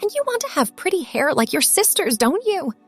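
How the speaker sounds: insistent motherly prodding; relentless. A narrator's reading. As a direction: keep pushing for a yes. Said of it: "Mom asked relentlessly".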